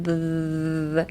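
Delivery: "the" with a long, soft th buzz; vibrating th. Shown as a demonstration. Teacher showing how to bite the tongue, buzzing th. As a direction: hold the buzzing th in "the", tongue lightly bitten. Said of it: "and make a vibration".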